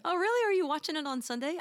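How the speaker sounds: high, loud delighted question; light up with eager surprise; excited. Real, not acted. Excited at shared favorite.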